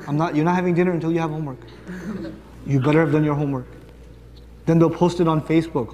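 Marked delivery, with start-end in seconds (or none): 0.1-3.7 quick, low parental threat; nagging parent. Acted. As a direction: warn the kid fast and low. Nagging parent threatens over dinner.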